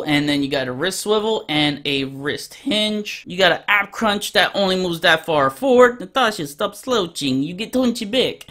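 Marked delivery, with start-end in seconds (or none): 6.0-8.4 hammed-up bad Russian accent; terrible Russian accent. Reviewer scolds Natasha for slouching, bad Russian accent. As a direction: put on a badly faked Russian accent. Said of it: "That's my terrible Russian accent".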